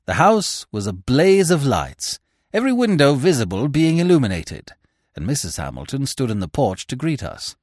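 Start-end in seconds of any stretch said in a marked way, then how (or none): none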